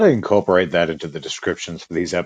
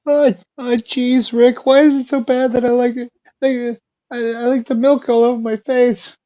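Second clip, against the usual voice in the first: put-on much higher nervous stammering teen voice; Morty impression. Morty answering Rick, high and stammering. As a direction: fluster through it like Morty.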